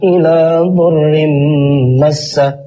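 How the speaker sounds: slow, melodic Quranic chanting; Quran recitation. Acted. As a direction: chant the verses slowly and melodically.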